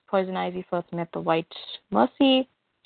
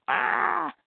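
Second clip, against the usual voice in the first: mock pained cry; 8 semitones higher than usual. Clark cries in pain.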